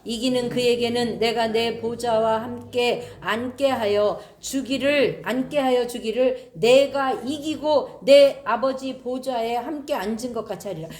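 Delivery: solemn verse reading; scripture reading. Acted. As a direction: proclaim the verse slowly. Reads scripture aloud.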